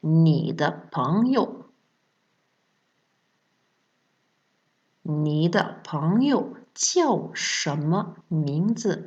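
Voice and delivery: "Ni de pengyo jiao shenme mingzi" slow and careful; slow model phrase. Shown as a demonstration. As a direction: say it slowly so they can repeat. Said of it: "répétez après moi".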